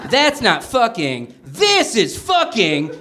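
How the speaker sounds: slow, smug drawl; David Spade impression. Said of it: "cried Spade".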